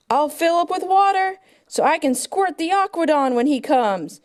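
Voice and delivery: ordinary talk, nothing marked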